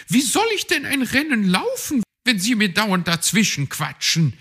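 high, fast indignant magpie voice; complaining. A narrator's reading. Magpie complaining to Herr Fuchs about his chatter, high and indignant. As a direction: snap back at the meddling coach. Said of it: "beschwerte sie sich".

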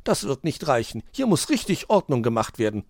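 high, indignant magpie scolding; ill-humoured. A narrator's reading. Magpie rejects the fox's little tidy-up, ill-humoured. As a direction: object crossly, starting loud. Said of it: "erwiderte die Elster missgestimmt".

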